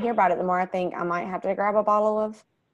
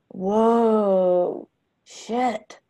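put-on amazed kid; 3 semitones higher than usual. Amazed boy in science class.

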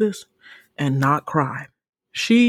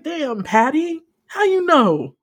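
amazed outcry; 7 semitones higher, 5 dB louder than usual. Retold or imitated. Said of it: "I was just like".

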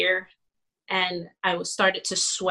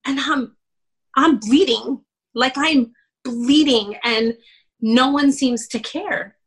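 high, loud anguished cry to the Lord; mock scream at God; 6 semitones higher, 3 dB louder than usual. Pastor's wife reliving screaming at the Lord, high and loud. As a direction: cry out to God as if bleeding inside. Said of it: "screaming at the Lord".

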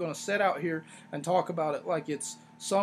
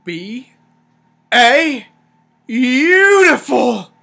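BEAUTIFUL broken up, stretched and loud; drawn-out emphasis; 5 semitones higher, 15 dB louder, much slower than usual. Real, not acted. Reviewer gushing over a wrestling performance, drawn-out emphasis. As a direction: belt out B-A-beautiful, very slowly.

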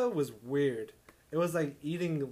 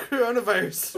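laughing; 7 semitones higher, 9 dB louder than usual. Real, not acted. Joke, laughing.

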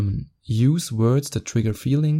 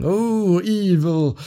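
high, hammed-up horrified voice; mock outrage; 7 semitones higher, much slower than usual. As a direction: pretend to be scandalized. Mocking outrage at man spreading.

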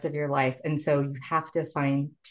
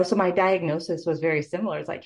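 ordinary talk, nothing marked

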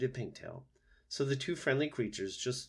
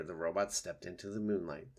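plain reading voice

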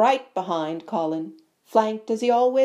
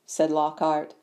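ordinary delivery, nothing marked

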